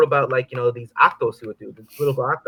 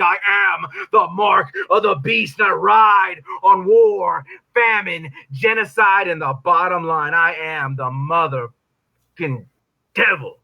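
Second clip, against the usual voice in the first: stage shout of the devil; shouting devil climax; 4 semitones higher, slower than usual. Acted. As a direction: roar it out as the devil himself. Shouted climax of persona poem.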